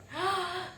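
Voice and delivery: sharp delighted gasp; excited gasp. Real, not acted. Gasping to viewers over the meet cute. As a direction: gasp for joy.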